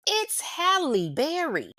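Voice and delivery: high, slow, drawn-out it's Halle Berry in disbelief; incredulous emphasis. Real, not acted. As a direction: stretch it out like it's obvious. Incredulous repeat of reason.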